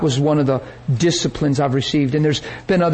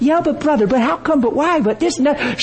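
much higher whiny objecting voice; mock nitpicking skeptic; 10 semitones higher than usual. Preacher parodies intellectual churchgoers' objections, high whiny mock voice. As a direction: whine out the endless buts. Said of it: "We get so intellectual".

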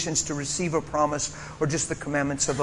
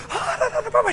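much higher shouted da da babble; imitated tongues; faster than usual. Preacher imitating the girl praying in tongues, high shouted babble. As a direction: belt out the child's tongues.

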